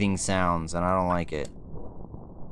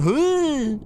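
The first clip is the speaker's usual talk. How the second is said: confused dude; 4 dB louder than usual. Retold or imitated. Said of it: "a dude standing there going".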